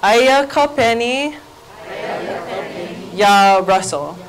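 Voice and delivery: slow "Aya ka penny", long pause, then slow "ya Russell"; call-and-response model. Shown as a demonstration. Teacher leading everybody through the example, slowly in chunks. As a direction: split it in two for learners to echo. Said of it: "So everybody say".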